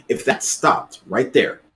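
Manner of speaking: usual speaking voice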